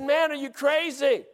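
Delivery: high, exasperated full voice; talking out loud. Retold or imitated. Hunter to his whispering buddy, exasperated out loud. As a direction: drop all hush, protest out loud. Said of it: "I'm talking out loud".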